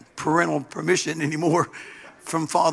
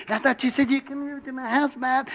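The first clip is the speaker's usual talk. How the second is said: voicing Shirley; 7 semitones higher than usual. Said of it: "Shirley said".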